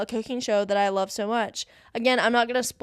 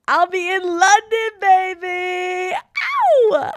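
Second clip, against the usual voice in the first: excited squeal; 9 semitones higher, 7 dB louder, much slower than usual. Real, not acted. Excited about London birthday trip.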